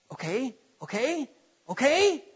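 hanging on the next word; hushed, expectant, questioning okays; eager anticipation. Acted. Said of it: "be on the edge of your seat saying".

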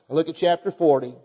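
normal talking voice

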